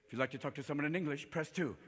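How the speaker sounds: low, soft recorded menu voice; quiet, impersonal phone robot; automated phone menu. Acted. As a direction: recite it like a phone tree.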